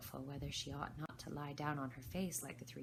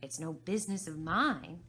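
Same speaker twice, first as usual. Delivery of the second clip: louder, cheeky dismissal; defiant; 8 dB louder than usual. Acted. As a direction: shrug her off. Alice defies the Queen.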